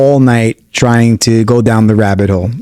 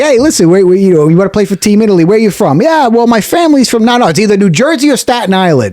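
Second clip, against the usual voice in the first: Jersey guy; 9 semitones higher than usual. Retold or imitated. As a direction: push him about where he's from.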